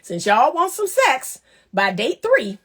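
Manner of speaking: ordinary talk, nothing marked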